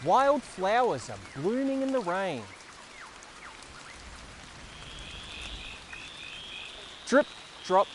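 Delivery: put-on slow, sing-song reading for small children; picture book reading. Reading Thank You Rain aloud to young viewers, gentle storytelling. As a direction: read it out like story time, savouring each word.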